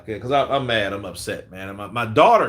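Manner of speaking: usual speaking voice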